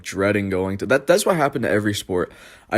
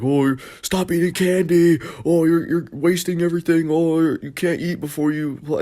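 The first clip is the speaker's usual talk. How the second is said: high, whiny mock scolding; imitated mad kid; 8 semitones higher than usual. Teammate scolding him over candy before the game, mock mad. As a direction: mimic a kid getting all worked up, voice pitched way up. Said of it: "He was just getting all mad".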